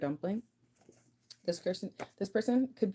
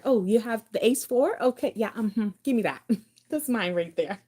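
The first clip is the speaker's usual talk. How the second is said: louder, higher, triumphant taking; gleeful card grab; 3 semitones higher, 8 dB louder than usual. Acted. The person who holds the card, gloating as they take it, loud and gleeful. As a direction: crow it out louder as you snatch the card. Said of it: "That they, they have the card".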